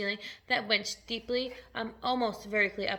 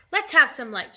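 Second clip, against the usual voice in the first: louder, short narrated shout; 3 semitones higher, 10 dB louder than usual. A narrator's reading. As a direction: call out for light. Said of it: "shouted the centipede".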